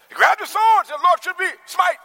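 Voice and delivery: high, loud preaching cry; fiery preaching. Acted. Preacher telling of Peter, high and loud. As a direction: cry it out to the church. Retelling Peter at full force.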